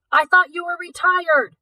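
high, loud call after her. Acted. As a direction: shout it up the shaft. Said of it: "Nick called out".

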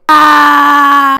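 loud mock scream in the rain; imitated scream. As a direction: mimic the rider screaming. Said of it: "She was screaming".

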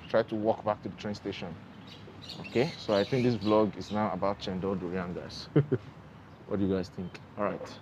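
muffled behind mask; speaking with covered mouth; through a face mask. Real, not acted. Seen 3: Surgical mask covers mouth and nose throughout; reference frames unmasked.

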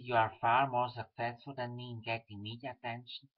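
ordinary talk

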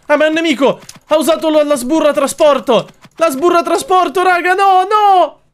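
very high, loud frantic shouting; panicked yelling. Real, not acted. Streamer shouting to viewers as an enemy strikes. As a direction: yell in alarm, rising to a desperate no.

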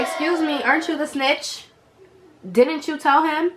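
high, loud mock outrage; sassy, affronted comeback; indignant retort. Retold indignant retort to mom.

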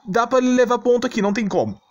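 normal talking voice

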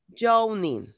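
Yawning said as "JOH-nin", falling at the end; modelled pronunciation. Shown as a demonstration. Teacher answers pupil who forgot the word, modelling Yawning as JOH-nin. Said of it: "¿cómo se pronuncia?".

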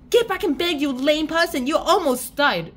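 loud, high-pitched telling-off; mock scolding. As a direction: order the invalid back to bed, loudly. Acted scolding of the boy.

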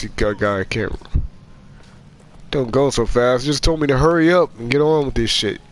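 ordinary talk, nothing marked

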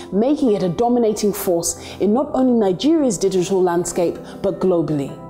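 scripted profile voiceover with lifted phrase openings; documentary narration. A narrator's reading. Introducing the founder of a digital media business, narrated profile read.

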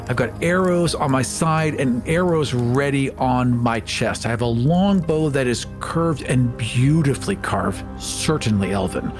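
usual speaking voice

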